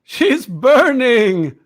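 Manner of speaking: slow, high, loud gloating; gloating villager. Acted. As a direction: savour it like a cruel villager.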